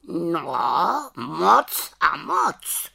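high, slow, drawn-out raven cry; raven exclaiming. Acted. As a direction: call it out like a raven. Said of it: "zvolal Havran". (zvolání havraním hlasem)

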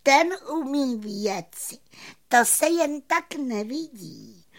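very high, admiring fairy voice; delighted little fairy. A narrator's reading. Fairy praising the magician to friends, high and delighted. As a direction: marvel proudly in a tiny high voice. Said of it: "rozářila se potěšeně plavůňka".

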